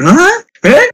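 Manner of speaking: high squeaky shocked fangirl voice; excited anime fan. Retold or imitated. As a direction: gasp it out like a stunned Japanese idol fan. Imagining [name] members gossiping.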